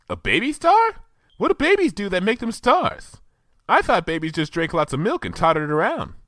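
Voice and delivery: very high, puzzled pony voice; Pinkie Pie voice. Acted. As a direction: squeak it up high in confusion.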